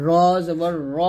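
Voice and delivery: "র" with a rounded o sound; correct thick vowel. Shown as a demonstration. Teacher telling students how to say it, correct vowel. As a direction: say "র জবর র" heavy, ending in o. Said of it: "বলতে হবে".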